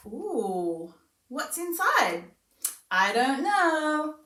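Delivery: high, slow, sing-song wonder; slow teasing sing-song for a toddler; playful demo to child. Shown as a demonstration. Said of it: "this is my pretend child, I might say".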